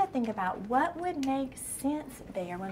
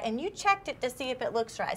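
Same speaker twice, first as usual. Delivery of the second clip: ordinary talk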